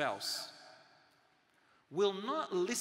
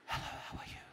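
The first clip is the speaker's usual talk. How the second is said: hushed, voiceless whisper; 6 dB quieter, faster than usual. Shown as a demonstration. Speaker shows the audience how to whisper to a neighbour, whispering. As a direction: whisper it softly, without voice. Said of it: "That's not whispering. Like this".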